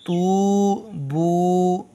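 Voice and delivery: तू बू held very long and slow; slow Quran reading drill; both vowels drawn out. Shown as a demonstration. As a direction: stretch the ऊ of तू and बू. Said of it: "ये दोनों को खींचना होता है".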